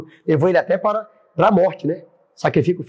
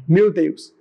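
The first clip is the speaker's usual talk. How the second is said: normal talking voice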